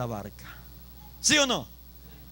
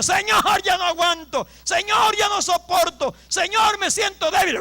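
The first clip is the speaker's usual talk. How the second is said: high, loud pleading shouts; acted crying out; 11 semitones higher, 6 dB louder than usual. Preacher voicing a weak believer calling on God, crying out. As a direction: call on the Lord at full voice, phrase after phrase. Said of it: "usted le clama y le diga".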